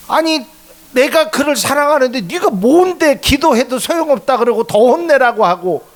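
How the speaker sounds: God voiced rebuking Job's friends; indignant rebuke. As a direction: rebuke the meddler for scolding your child.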